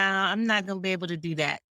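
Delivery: rattled off fast and final; quick brush-off. Retold or imitated. Host quotes her boundary phrase, quick and dismissive. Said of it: "both of ours is".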